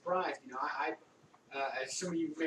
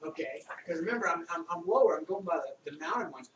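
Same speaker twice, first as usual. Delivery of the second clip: ordinary talk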